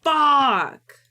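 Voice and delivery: loud, high falling curse; frustrated curse. Real, not acted. Streamer curses at re-recording lines, loud frustration. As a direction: spit it out in real annoyance.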